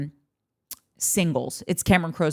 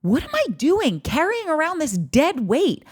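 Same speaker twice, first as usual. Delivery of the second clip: exasperated outburst; 9 semitones higher than usual. Retold or imitated. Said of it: "They both realized".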